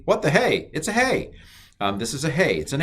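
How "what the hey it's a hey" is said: high, playful double take; jokey what-the-hey surprise; mock surprise. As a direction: act startled by the letter's name. Mock shock at the letter's name.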